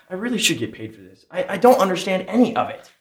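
normal talking voice

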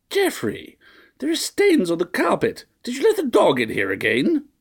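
very high, scolding matron; shrill old lady. A narrator's reading. Fussy mistress nagging her butler, high and shrill.

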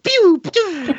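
very high mimicked game blips; video game zaps. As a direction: squeak out little laser shots. Imitating fake video game sounds.

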